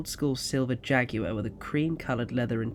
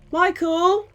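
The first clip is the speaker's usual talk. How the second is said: much higher, a calm call across the flat; 7 dB louder than usual. Acted. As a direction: call him from another room. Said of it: "called out".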